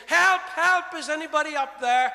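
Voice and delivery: loud, high help, help; panicked cry for help. Acted. Man clinging to a branch, calling out in panic. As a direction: shout up the cliff in panic. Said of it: "he began to say".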